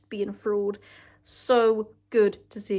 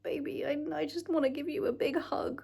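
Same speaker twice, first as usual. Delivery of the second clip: soft, higher, breathy comforting whisper; tender whisper; 6 semitones higher, 7 dB quieter than usual. Retold or imitated. Fan comforting a character she loves, tender whisper. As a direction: whisper to her like hugging a child. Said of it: "and I'm like".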